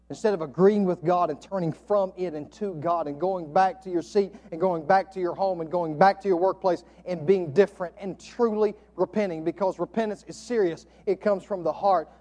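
ordinary talk

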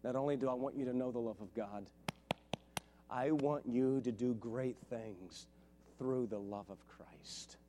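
hushed, heartfelt sermon close; soft tender preaching. Real, not acted.

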